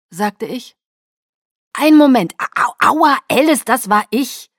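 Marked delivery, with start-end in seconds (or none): 1.7-4.5 high, loud yelping complaint; loud, indignant ouches; pained protest. Acted. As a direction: yelp and complain as if pricked. Father pricked, yelping in protest.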